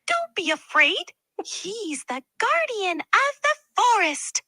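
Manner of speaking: high chirping squirrel voice; chirpy squirrel. Acted. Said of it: "squirrel chirped in".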